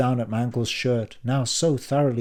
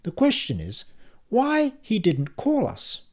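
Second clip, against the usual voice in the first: mother's reproach; 11 semitones higher than usual. A narrator's reading. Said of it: "my mother said".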